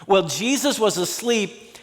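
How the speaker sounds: ordinary delivery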